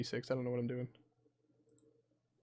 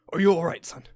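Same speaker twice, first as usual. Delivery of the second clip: a captain's raised, concerned question; urgent concerned captain; 3 semitones higher, 9 dB louder, faster than usual. Acted. Captain rushing to a bitten passenger, urgent concern. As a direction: call out higher and much louder.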